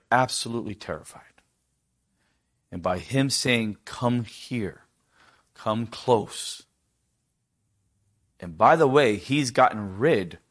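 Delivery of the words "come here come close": soft invitation. Retold or imitated. Joseph calling brothers near.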